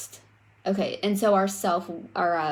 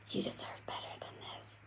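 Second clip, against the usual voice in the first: soft breathy whisper of an inner voice, a little quick; 18 dB quieter, faster than usual. Acted. The inner voice urging you when you're down, whispered. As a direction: whisper it like a gentle nudge inside your head. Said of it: "that voice creeps up and it goes".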